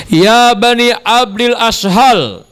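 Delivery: high, slow acted summons to a crowd; calling out. Chief addressing his assembled clan, acted calling out. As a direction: call the whole clan to attention.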